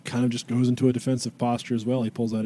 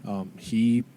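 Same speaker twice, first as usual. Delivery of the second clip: normal talking voice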